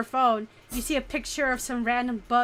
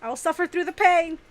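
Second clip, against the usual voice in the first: hammed-up tragic, higher and louder; dramatic suffering; 5 semitones higher, 6 dB louder than usual. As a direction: play the martyr over ice cream. Two takes of character line.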